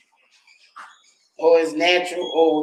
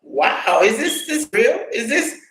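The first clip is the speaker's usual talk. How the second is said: disbelief; slower than usual. Retold or imitated. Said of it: "I was like".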